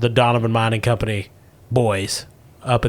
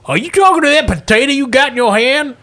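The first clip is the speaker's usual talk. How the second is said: put-on old prospector; 12 semitones higher, 3 dB louder than usual. [name] puzzled by potato.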